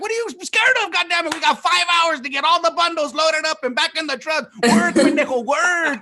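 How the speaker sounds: high-pitched, exasperated mock yell; mock angry scolding. Field workers scolding someone scared of cornfields, high mock-angry yelling. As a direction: hound a slacker in an acted rant.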